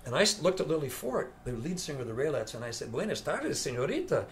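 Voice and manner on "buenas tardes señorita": a mock Spanish greeting, pitched higher; showing off mock Spanish; hammed-up Spanish. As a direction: ham it up as a fake Spaniard. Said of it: "Don, what is wrong with you?".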